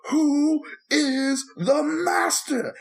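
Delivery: high, slow, drawn-out villain taunt; movie villain taunt. Retold or imitated. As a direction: stretch every word like a gloating villain. Retold movie villain taunting again.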